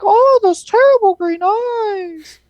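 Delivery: slow, very high, loud hammed-up cry; mock terrified wail. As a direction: draw out the fake horror in a piercing high voice. Quoting Aunt May's frightened cry.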